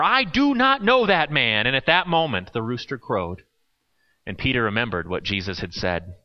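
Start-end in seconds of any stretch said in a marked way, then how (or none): 0.0-1.7 slow oath, higher with punch on do; sworn denial. Acted. Peter to the bystanders, swearing his denial in anger. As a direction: swear it, each word hammered. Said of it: "he began to curse. And he swore".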